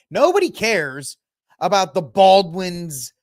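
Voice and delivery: ranting that nobody cares; emphatic rant. Real, not acted. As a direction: hammer home that nobody cares.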